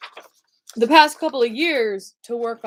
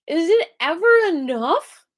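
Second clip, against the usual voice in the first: anxious inner voice; 10 semitones higher, 6 dB louder than usual. Retold or imitated. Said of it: "that feeling is".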